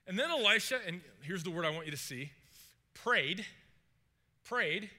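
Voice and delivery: ordinary talk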